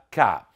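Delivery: cá dropped low, as the weaker syllable; weak unstressed syllable. Shown as a demonstration. Teacher showing the weak syllable of café, cá pitched low. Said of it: "que é a mais fraca".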